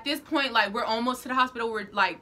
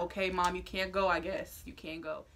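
resigned quiet; 4 semitones lower, 8 dB quieter than usual. Retold or imitated. Giving in, mom stays behind.

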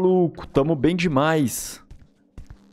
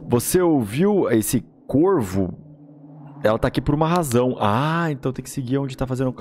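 ordinary delivery

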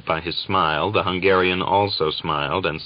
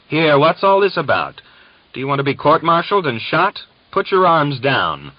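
much higher, louder command, fast then slowing on the order; 9 semitones higher, 6 dB louder than usual. A narrator's reading. Sergeant steps between Gregor and Uryupin, loud command. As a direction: bellow them apart with authority. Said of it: "the sergeant commanded".

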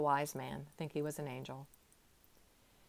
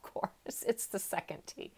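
ordinary talk

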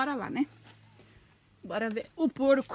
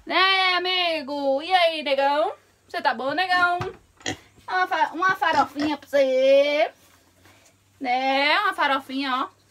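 excited pet talk; 3 semitones higher, 6 dB louder than usual. Real, not acted. Farmer gushing loudly at the pig while feeding it. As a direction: gush over the pig as you feed it.

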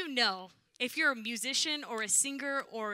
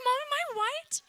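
put-on much higher, squeaky little-kid voice; high squeaky kid asking her mother; childish whine; 7 dB louder than usual. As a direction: play a clueless small child asking mom. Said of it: "I was like".

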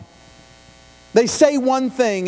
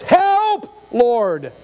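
pleading cry; 2 semitones higher than usual. Acted. Reading a psalm's cry for help.

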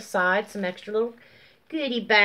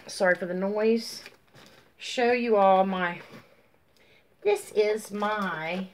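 usual speaking voice

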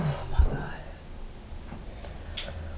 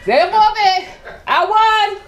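gloating; 10 semitones higher, 3 dB louder than usual. Real, not acted. Gloating victory.

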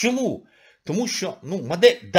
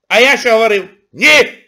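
loud scolding; 7 semitones higher, 12 dB louder than usual. Real, not acted. Scolding the audience.

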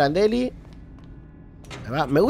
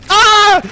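sudden high, loud jump-scare scream; terrified scream; 13 dB louder than usual. Real, not acted. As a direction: scream from a real fright. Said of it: "No puedo gritar". (grito de susto real, agudo y fuerte)

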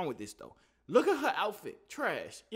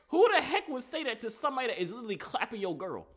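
ordinary talk

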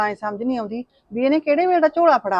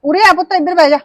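calling over; 7 semitones higher, 6 dB louder than usual. Acted. Mother calls daughter-in-law over.

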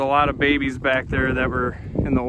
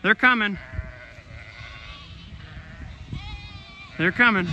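talking to a dog; 2 semitones higher, 4 dB quieter than usual. Real, not acted. Telling dog sheep come.

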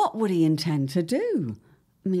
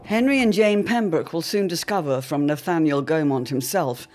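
ominous cliffhanger narration; dramatic narrator; 3 semitones lower, 4 dB louder than usual. A narrator's reading. Narrator closing the episode on a cliffhanger, ominous. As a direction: leave the listeners hanging on the question.

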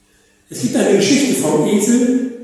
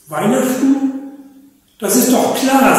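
a lightly voiced fox, a touch higher and certain; the fox settles it with a raised voice; sure fox; 7 semitones higher than usual. Fox tells the animals: confident, slightly high. Fox declares his view.

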